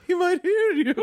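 high mock gossip voice; imitating the Emily impression. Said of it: "love your Emily impression".

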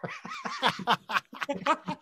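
long high-pitched laughter; high delighted laugh. Real, not acted. Storyteller cracks up at the actor's remark, laughing hard. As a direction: burst out laughing at your own anecdote.

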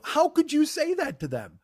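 high-pitched, aggrieved disbelief; incredulous, voice way up; mock-offended. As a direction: reproach a friend as if wounded. Acted hurt reproach to friend.

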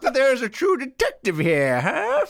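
high, slow whistling gopher. Retold or imitated. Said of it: "that whistle was really impressive".

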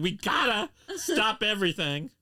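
high, loud mock urgency; excitedly reenacting a big announcement; urgent retold announcement. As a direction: call a halt to the whole room. Retells urgent announcement as a child.

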